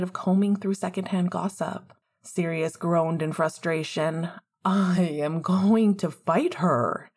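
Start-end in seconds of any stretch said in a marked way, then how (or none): 4.6-7.1 slow, louder mock threat; slow declaration. Sirius declaring mock war on Lily. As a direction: declare it slowly, hammed up. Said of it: "he declared".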